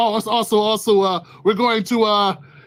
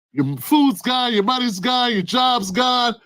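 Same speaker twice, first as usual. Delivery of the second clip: slow listing; 3 semitones higher, slower than usual. Acted. Listing losses in despair.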